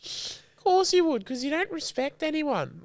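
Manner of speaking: high, slow mimicking whine; mock him back in a silly high voice; mocking whiny voice. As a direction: parrot his words in a high mocking squeak. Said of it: "Have a fucking joke".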